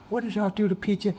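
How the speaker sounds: high, fast worried demand; alarmed question. Retold or imitated. Father asking the family about his son, high and fast.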